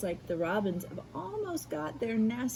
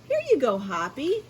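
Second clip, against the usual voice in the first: higher, louder friendly woodcarver; Buster Birch; 4 semitones higher, 7 dB louder than usual. Acted. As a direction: hand it over cheerfully, pitched up. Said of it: "Buster Birch said".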